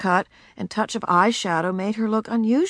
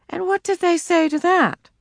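mother voice; 11 semitones higher than usual. A narrator's reading.

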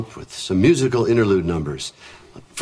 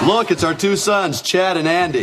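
high, laughing hammy TV father; exaggerated sitcom dad; 6 semitones higher than usual. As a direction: present the kids proudly, overplayed. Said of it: "are you sure you want it that exaggerated".

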